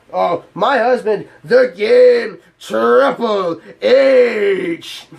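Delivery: hammed-up imitation of a forced manly intro; mocking Stephanie. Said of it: "tries masculating her voice even more".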